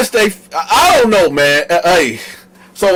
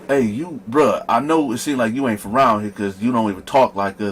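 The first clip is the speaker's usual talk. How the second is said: low, quiet, heavy big-dude warning; hushed deep bouncer aside; deep security guard voice; 9 semitones lower, 7 dB quieter than usual. Retold or imitated. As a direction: lean in low like a big bouncer tipping him off.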